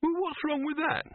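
very high, put-on indignant voice; indignant demand. A narrator's reading. Silverfish defending his posters, high indignant demand. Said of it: "Silverfish demanded".

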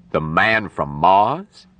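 slow, disbelieving echo rising on Mars; astonished question. Acted. Doctor questions nurse's startling news, astonished. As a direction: repeat it slowly as a startled question.

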